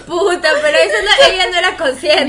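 loud laughing protest; laughing hard. Real, not acted. Reacting to the other woman story, loud laughter. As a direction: burst out laughing then object.

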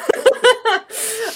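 high burst of laughter; big laugh. Real, not acted. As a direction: laugh out loud, high and hard. Laughing at friend's impression.

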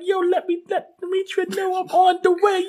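very high put-on helper voice; Alpha's excited call. Alpha telling Demetra he's on the way, high excited voice. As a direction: pitch it up, chattering eagerly. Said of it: "he's like".

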